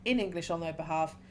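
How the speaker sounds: ordinary talk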